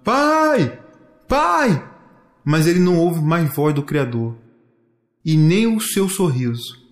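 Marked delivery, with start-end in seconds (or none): 0.0-1.9 high, drawn-out cry for father; long high-pitched call. A narrator's reading. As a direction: holler for your dad, searching.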